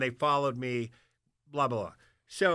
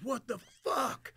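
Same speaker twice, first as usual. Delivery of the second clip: retold outburst; 7 semitones higher, 6 dB quieter, much slower than usual. Pat yells at narrator leaving.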